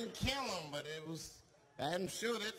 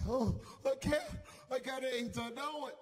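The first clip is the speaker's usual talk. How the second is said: mock panic; 5 semitones higher than usual. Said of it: "scared victim".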